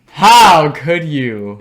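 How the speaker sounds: loud, drawn-out mock outrage; mock betrayal. Host reproaching John for his rating, mock betrayal. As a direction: stretch it out high and wounded.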